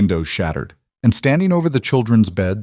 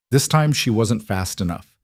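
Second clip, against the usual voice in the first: usual speaking voice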